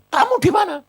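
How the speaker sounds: high, loud retold demand; wife on the phone. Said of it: "Dia bilang". (tiruan istri bertanya, tinggi dan keras)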